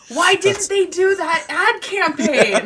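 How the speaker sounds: high and slow through laughter; laughing. Real, not acted. To friends, laughing at the Snickers clown joke. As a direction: ask while still laughing.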